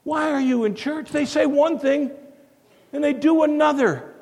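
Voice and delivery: retold challenge. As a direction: half-shout it again to make the point. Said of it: "he asked this great question".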